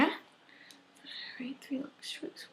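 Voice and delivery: soft whispered count; whispering. Real, not acted. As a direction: whisper it while you work. Counting strokes during therapy.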